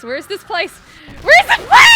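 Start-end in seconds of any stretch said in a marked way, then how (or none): 1.2-1.9 much higher, loud, shrill shriek; shrieked question. Real, not acted. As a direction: shriek it in disbelief. Repeating the line, then shrieking it.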